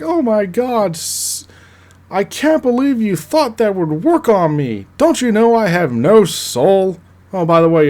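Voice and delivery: high, loud sneering villain voice; mocking villain Jack. Villain Jack taunting Pietro's puppy eyes, high mocking sneer. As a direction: scoff at him like a soulless cartoon baddie. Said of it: "and Jack's like".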